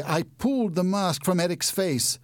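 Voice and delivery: plain reading voice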